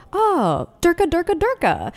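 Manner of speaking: hammed-up high-pitched gibberish greeting; mock excited welcome. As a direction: cry it out high, welcoming one of your own. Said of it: "Hey, welcome".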